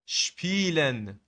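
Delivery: شپیلن drawn out slowly, starting with sh; slow شپیلن, initial s said as sh; sh sound. Shown as a demonstration. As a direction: stretch شپیلن with a clear ش before the p.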